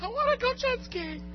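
tiny high voice, no longer shouting; mock pleading. Preacher replaying his wish to God, small high mock plea. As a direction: squeak out the wish at ordinary volume.

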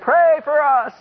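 imitated panicked cry, pitched high; high-pitched mock-panicked call; retold panicked plea. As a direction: mimic a desperate plea for help. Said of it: "in a tearful, panicked voice".

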